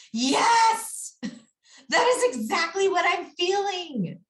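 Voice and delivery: high, loud mock outburst of relief; elated agreement. Client to a leader who validated their feelings, elated agreement. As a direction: exclaim it high and loud, as if finally understood. Client's delighted reply acted out.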